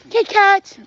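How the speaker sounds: high, loud repeat of the name; excited little shout. Real, not acted. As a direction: call it out brightly. Excited pointing at candy.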